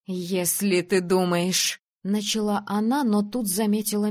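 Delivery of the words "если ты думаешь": slow, measured warning; threatening. A narrator's reading. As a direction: start a threat, weighing each word. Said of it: "глаза Зои угрожающе сузились". (угрожающе, с растяжкой)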